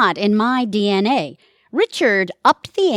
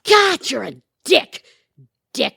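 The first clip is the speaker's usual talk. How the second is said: angry insult; 4 semitones higher, 3 dB louder than usual. Acted. Marital fight insult.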